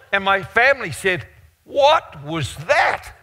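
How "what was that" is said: slow, high-pitched disbelief; astonished question. Acted. Preacher voices his family after the hug, astonished question. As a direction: stretch out each word in amazement.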